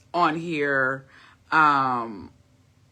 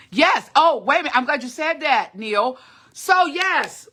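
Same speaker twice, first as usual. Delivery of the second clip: excited; 9 semitones higher, 3 dB louder than usual. Real, not acted. Reacts to a viewer comment.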